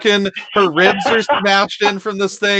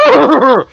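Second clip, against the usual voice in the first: louder growling shout; 8 semitones higher, 7 dB louder than usual. Acted. Druid shouting the beast speech, growled shout. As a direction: bellow it out. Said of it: "you shout out".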